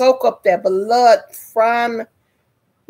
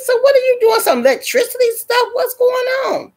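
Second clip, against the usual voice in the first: high-pitched retold panic at the doctor; alarmed questioning. Her alarmed reaction to doctor.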